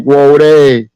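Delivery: "Whoa, they" drawn out as one slang call; imitated Louisiana slang. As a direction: stretch the end of "Whoa, they". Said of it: "back then they used to be like".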